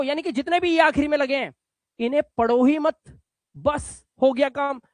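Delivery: usual speaking voice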